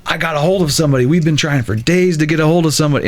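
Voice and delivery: mock-frantic, high-pitched retelling; freaking out. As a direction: blurt it out like a frantic teenager. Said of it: "he's freaking out".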